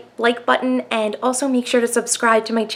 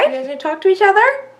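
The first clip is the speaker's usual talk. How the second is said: high-pitched coaxing question; cutesy toy talk; 11 semitones higher than usual. Real, not acted. Owner asking two Furbies to chat, high cutesy voice. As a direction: pitch it up as if to little pets.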